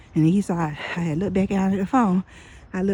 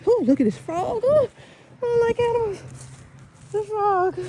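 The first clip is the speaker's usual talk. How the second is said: high squeal at a frog; startled, high-pitched recoil; real squeamish alarm; 14 semitones higher than usual. Real, not acted. Spots a frog, dislikes animals.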